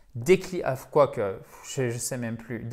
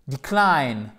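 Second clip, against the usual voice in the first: "decline" louder, falling on CLINE; accent sur la fin; 5 dB louder than usual. Shown as a demonstration. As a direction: drop heavily onto the end of "decline". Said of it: "sur la fin du mot". (« decline » qui tombe sur la seconde syllabe)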